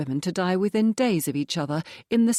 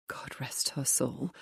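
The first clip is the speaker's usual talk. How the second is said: softer, slow murmured blessing; whispered aside; 4 semitones lower, 10 dB quieter than usual. A narrator's reading. Lucy blessing the dead mother in a slow whisper. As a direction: drop to a slow whisper, a quick pious aside.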